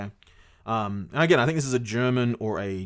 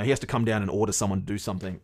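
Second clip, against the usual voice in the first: ordinary talk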